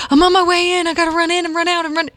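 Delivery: high, rushed, breathless imitation of a running teen; imitating out of breath. Son calling mom while running into school, mock out of breath. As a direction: pant it out fast and high, as if mid-run. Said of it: "He's running, out of breath".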